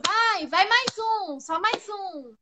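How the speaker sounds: loud, high coach yelling encouragement; pushy trainer. Retold or imitated. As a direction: push them hard like a gym instructor. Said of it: "porque o professor".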